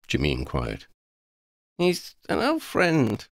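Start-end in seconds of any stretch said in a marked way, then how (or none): 1.8-3.3 slow, thoughtful and higher; pensive. A narrator's reading. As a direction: muse over it slowly. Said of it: "Lydia said pensively".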